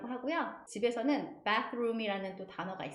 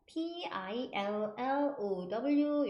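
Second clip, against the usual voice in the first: slow, letter by letter; drawn-out separate letters; spelling out; much slower than usual. Shown as a demonstration. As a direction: spell P I L L O W for learners. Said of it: "이렇게 쓰고요".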